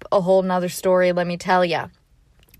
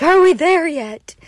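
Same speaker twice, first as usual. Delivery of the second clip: high, loud, whiny complaint; impatient whine; 9 semitones higher, 6 dB louder than usual. Retold or imitated. Hiker whining on the steep climb back up, impatient. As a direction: whine it like a tired kid. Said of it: "not very patient going back up".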